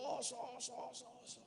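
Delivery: soft distant echo fading out; faraway echo. Retold or imitated. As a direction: repeat oso as it dies away. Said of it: "Y ahí le respondió".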